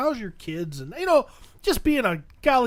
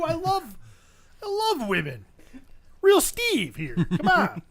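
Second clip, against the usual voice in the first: higher-pitched, mildly indignant plea; pleading protest; 7 semitones higher than usual. Retold or imitated. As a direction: plead your case, pitch raised. Retold protest in a quarrel.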